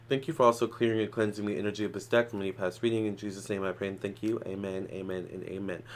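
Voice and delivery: protection prayer. Real, not acted. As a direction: pray over the cards calmly.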